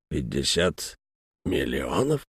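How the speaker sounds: quiet, dazed disbelief; stunned. A narrator's reading. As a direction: repeat it in shock, hushed. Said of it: "оторопело повторил нотариус".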